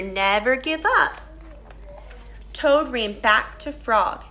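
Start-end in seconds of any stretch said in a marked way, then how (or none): none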